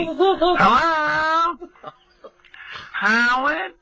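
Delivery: high, calling mock yell; mock yelling hello. Mother yelling into the answering machine, high mock call. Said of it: "she's yelling into the answering machine".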